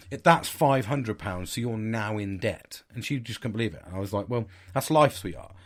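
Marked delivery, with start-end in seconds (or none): 0.1-2.8 slow deadpan. Retold or imitated. 4.3-5.5 sarcastic. Retold or imitated. Sarcastic sign-off.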